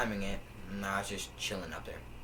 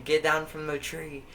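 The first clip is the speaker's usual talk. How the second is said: louder, higher mock order; imitated old man; 7 semitones higher, 6 dB louder than usual. Old man ordering kids out of a tree, raised mock yell. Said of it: "He's like".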